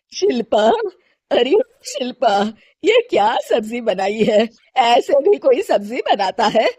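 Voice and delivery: mother-in-law finding fault with the food; lightly voiced stern elder; scolding mother-in-law.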